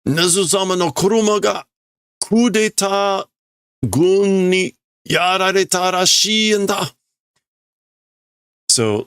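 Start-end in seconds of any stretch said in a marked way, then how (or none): 0.1-6.9 mock urgent report. Voicing guard reporting coup.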